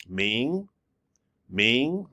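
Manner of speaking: ming rising like a question; rising second tone. Shown as a demonstration. Teacher showing the rising tone on ming. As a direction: let ming climb like you are asking. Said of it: "one that goes up, like a question".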